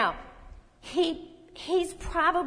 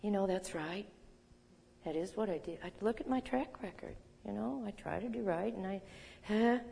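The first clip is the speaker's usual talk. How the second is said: much softer, resigned inner voice; dejected self-talk; 10 dB quieter than usual. Retold or imitated. Preacher voicing a discouraged believer's inner voice, softly. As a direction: mumble it as if beaten down.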